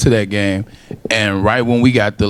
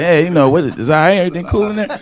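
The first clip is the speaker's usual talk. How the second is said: retold confusion, pitch climbing higher; puzzled; 8 semitones higher, faster than usual. Fan recalling an odd stadium noise, puzzled. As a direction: question it higher and higher, mock puzzled. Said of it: "I didn't understand".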